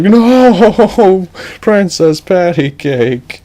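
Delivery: high loud drawn-out cry of loss; anguished cry. Acted. Edge seeing the princess eaten, high anguished cry. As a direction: wail her name as she's eaten.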